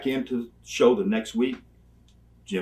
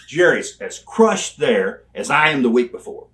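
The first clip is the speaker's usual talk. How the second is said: normal talking voice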